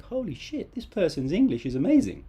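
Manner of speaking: mock astonishment, swinging up high; amazed listener. Listener thinking about a learner's impressive English, acted amazement. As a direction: imitate an impressed listener, pitch jumping high. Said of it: "the people who you are speaking to will think".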